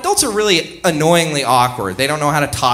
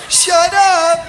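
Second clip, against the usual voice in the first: high, loud mock-mom scolding; hammed-up yell to hush the kids; 5 dB louder than usual. Mortified mom silencing her kids, mock scolding yell. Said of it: "their mom is just, like, choking 'em".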